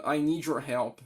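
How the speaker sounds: ordinary talk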